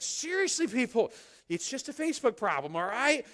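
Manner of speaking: high, loud mock exasperation; exasperated retort. Pastor answering panicked viewers, hammed-up exasperation. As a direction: roll your eyes at the worried crowd.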